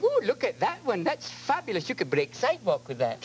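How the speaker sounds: put-on lightly Scots old woman, cooing; old Scottish lady. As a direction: marvel like a sweet Scottish lady. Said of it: "sixty-year-old Scottish lady going".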